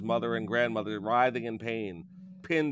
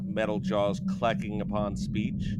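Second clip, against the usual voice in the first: plain reading voice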